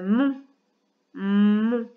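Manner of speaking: syllabe dictée, bien détachée; voix de dictée. Shown as a demonstration. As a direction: articule comme pour une dictée à l'école. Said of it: "faire une petite dictée de syllabes". (la maîtresse dicte une syllabe à l'élève, bien articulée)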